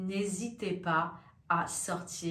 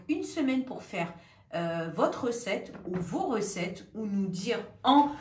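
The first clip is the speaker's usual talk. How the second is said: ordinary talk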